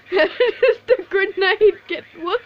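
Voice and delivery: breaking into laughter mid-question; high, giggly confusion; laughing at the absurd death; laughing disbelief. Real, not acted. Grenade kills him, laughing.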